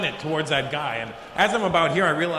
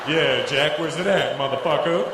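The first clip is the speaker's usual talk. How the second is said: gangster. Acted. Visitor acting tough to a bouncer, gangster. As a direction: swagger through it as a put-on gangster. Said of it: "I don't know why I turned into".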